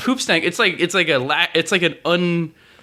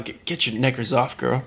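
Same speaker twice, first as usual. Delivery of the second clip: mock British pickup line; 6 semitones lower, 4 dB quieter than usual. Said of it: "I'm gonna tell that to a girl sometime".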